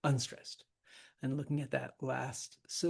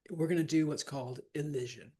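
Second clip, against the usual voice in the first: ordinary talk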